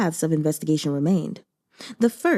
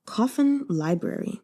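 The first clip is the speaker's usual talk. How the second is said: ordinary delivery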